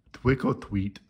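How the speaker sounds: Twick or tweet said with w for r, mouth stuffed; mouth full of candy. A narrator's reading. Morris with a mouth full of candy, garbled trick or treat. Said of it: "mouth was full of candy".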